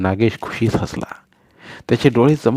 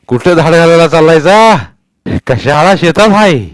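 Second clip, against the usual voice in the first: put-on old Patil's voice; 7 semitones higher, 9 dB louder than usual. A narrator's reading. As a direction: boom down at the little boy from the well. Patil laughing questions.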